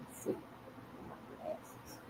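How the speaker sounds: breathy whisper to herself; whispered aside about her glasses. Real, not acted. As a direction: whisper it while looking around. Muttering while searching for glasses.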